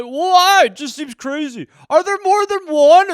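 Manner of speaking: high, loud naive protest; whining man-child. Acted. Grown son pesters parents on Tooth Fairy, bewildered. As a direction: whine like an overgrown kid. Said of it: "twenty-two-year-olds sitting at home".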